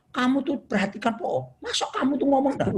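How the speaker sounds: high-pitched mock scolding; accusing nag. Wife blaming husband for not listening, high accusing nag. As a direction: act out a nagging, blaming complaint. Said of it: "tapi coba gini".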